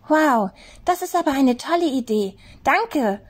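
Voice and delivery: excited high rabbit exclaiming; bursting with relief and joy; delighted high rabbit cry. A narrator's reading. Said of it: "rief Henry".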